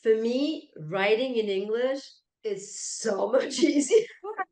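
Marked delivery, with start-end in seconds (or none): 2.4-4.5 laughing through the words. Real, not acted. Laughing mid-sentence at the contrast.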